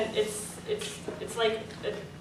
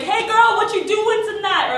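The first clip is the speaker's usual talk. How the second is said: high, loud come-on; catcaller; 9 semitones higher, 6 dB louder than usual. Acted. Mimicking a guy hitting on her, high loud catcall. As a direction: call out a pushy pick-up line.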